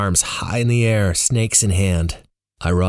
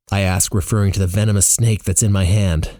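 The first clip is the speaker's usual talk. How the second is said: ordinary delivery, nothing marked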